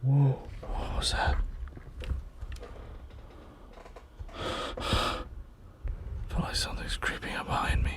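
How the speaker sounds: hushed, scared whisper; frightened whisper. Real, not acted. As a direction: whisper as if something is behind you. Scared, something behind him.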